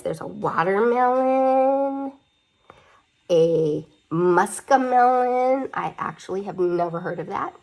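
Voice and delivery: ordinary delivery, nothing marked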